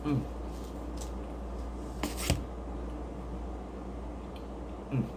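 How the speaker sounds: chewing with mouth full; messy loud eating. Real, not acted. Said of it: "I don't like talking with my mouth full".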